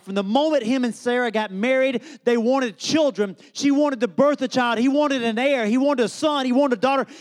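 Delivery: rising, insistent sermon cadence; building preaching. Real, not acted. Preaching builds through repeated phrases.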